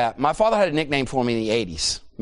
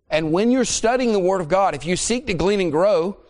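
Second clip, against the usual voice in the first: fervent preaching; 6 semitones higher than usual. Acted. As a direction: press the point home to the congregation. Preaching at full force.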